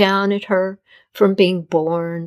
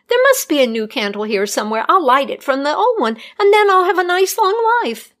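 fast, rising to a high hopeful pitch; desperate hope; 7 semitones higher than usual. A narrator's reading. Healer planning to relight her candle, desperate hope. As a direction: grasp at a last chance.

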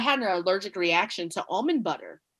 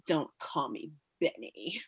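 put-on grumpy old man; 7 semitones lower, 11 dB quieter, slower than usual. Student's grumpy reply retold.

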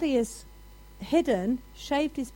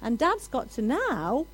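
ordinary talk

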